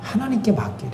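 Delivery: normal talking voice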